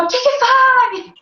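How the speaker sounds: slow, high sharp yell; retold yell at daughter. Mother reliving yelling at her restless baby, high slow yell. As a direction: snap at the toddler, stretched out. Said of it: "Eu gritei com ela".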